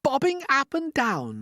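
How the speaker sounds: high, slow startled repeat; astonished echo. Acted. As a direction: echo it back in disbelief. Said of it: "they cried".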